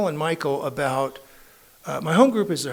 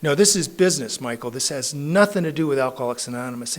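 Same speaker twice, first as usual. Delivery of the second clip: half-shouted whoa, waving it off; loud, alarmed brush-off; mock alarm, loud and insistent; retold loud protest; 8 dB louder than usual. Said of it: "I'm like".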